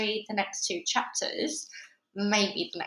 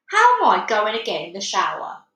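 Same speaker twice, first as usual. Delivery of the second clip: loud, exasperated demand; father demanding an answer from his wife, loudly; 9 dB louder than usual. Acted. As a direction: push the question at her, loud. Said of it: "he demanded".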